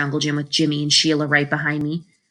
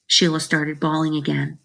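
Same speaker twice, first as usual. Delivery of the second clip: ordinary delivery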